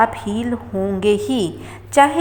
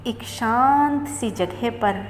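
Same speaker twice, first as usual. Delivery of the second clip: slow meditation voice with long pauses; unhurried relaxation guide, phrase by phrase; guided meditation; 3 dB quieter, slower than usual. Acted.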